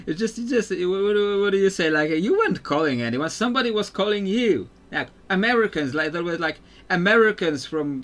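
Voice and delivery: high, loud, exasperated rant; worked up and incredulous. Real, not acted. Exasperated rant about late calls.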